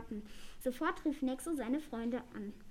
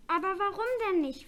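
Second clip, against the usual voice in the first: higher, louder dismayed question; sad; 6 semitones higher, 5 dB louder than usual. A narrator's reading. Little dragon protests being kept from school, sad. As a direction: plead a little, disappointed. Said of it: "fragte Nexo traurig".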